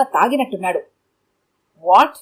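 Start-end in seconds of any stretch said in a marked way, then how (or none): 1.8-2.2 slurred. A narrator's reading. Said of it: "ముద్దగా అంటున్నాడు".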